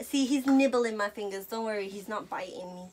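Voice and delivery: normal talking voice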